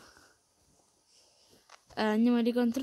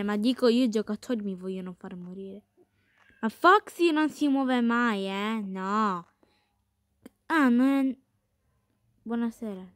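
ordinary talk

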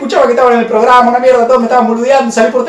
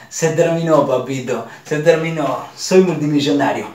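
smug low murmur; 9 semitones lower, 5 dB quieter than usual. Retold or imitated. Alone with the briefcase, a low satisfied murmur. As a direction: lower and softer, savouring it. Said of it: "y digo".

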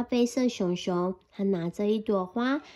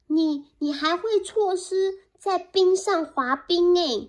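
higher little mouse voice; eager mouse; 6 semitones higher, 3 dB louder than usual. Acted. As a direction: keep pleading. Mouse not giving up.

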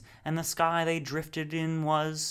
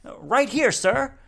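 eager shout, much higher and louder; cried out; 11 semitones higher, 8 dB louder, slower than usual. Acted. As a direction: snap to attention and yell it. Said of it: "cried the Space Wanderer".

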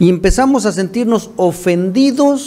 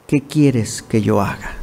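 slow, lower, hushed plea to the Lord; trembling and fearful; 7 semitones lower, 3 dB quieter, slower than usual. Acted. Preacher voicing Paul's fearful plea, slow and low. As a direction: ask it like a frightened man, slowly. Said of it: "Él temblando y temeroso dijo".

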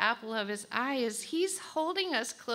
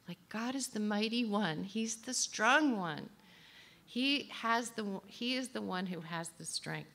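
usual speaking voice

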